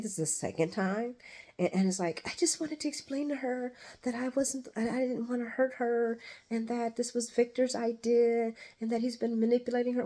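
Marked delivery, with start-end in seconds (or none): 2.3-10.1 higher-pitched pleading excuse; mock pleading. Woman explaining she meant no harm, high mock pleading. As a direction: imitate a high, earnest self-justification.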